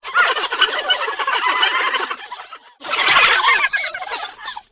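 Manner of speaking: very high, loud burst of giggles; a big happy giggle fit; children bursting into laughter; class laughing. A narrator's reading. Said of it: "made everyone start to laugh".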